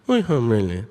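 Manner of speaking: put-on garbled, mumbled Cajun accent; unintelligible Cajun. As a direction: imitate a Cajun slurring words together. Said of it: "decipher that what he's saying is".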